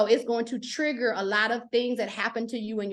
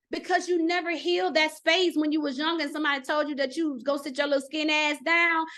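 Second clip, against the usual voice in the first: ordinary talk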